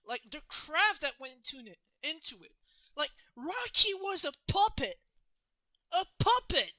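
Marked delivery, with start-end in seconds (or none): 3.3-6.7 loud, high insistence on the puppet; excited emphasis. Real, not acted. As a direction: hammer home that it was a puppet. Passionate praise of handmade puppet.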